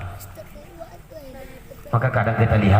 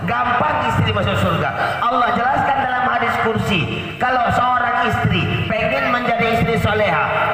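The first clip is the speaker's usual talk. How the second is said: high sermon voice counting off duties; rousing preaching; 10 semitones higher than usual. Real, not acted. Preacher listing a wife's duties for paradise, rousing high-pitched preaching. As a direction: lift each new point high and proclaim it.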